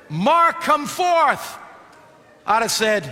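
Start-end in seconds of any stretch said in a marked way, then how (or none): 0.1-1.6 high, raised summons from the Lord; commanding call. Acted. The Lord calling Mark out of the grave, commanding call. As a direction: call out as if summoning someone from afar. The Lord calls the dead forth.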